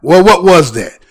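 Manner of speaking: normal talking voice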